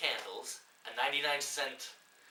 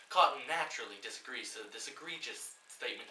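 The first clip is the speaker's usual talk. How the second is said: ordinary talk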